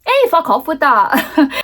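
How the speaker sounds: mock defiant rebuff. Villagers to the housing company, hammed-up defiant rebuff. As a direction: send them packing, slow and hammed-up.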